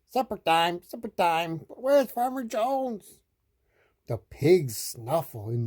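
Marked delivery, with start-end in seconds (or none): 0.1-3.2 high quacky duck; high-pitched duck chatter. A narrator's reading. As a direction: call out as a hungry duck. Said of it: "said the ducks".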